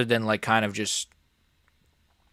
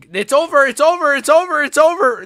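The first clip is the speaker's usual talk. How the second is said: rising excited yell, higher and louder; excited shout; 8 semitones higher, 6 dB louder than usual. Real, not acted. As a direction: call the stoppage out loud. Fight stoppage, streamer reacting live.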